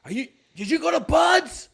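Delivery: high, loud interrogating yell; yelling officer. Retold or imitated. Warrant officer grilling the new guy, yelling. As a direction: fire the question out at a shout. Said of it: "He's just yelling at me".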